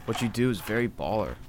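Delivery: soft, deadpan impression; understated movie-character voice, softly delivered; Kyle. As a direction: drop it quietly and evenly. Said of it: "your best Kyle impression".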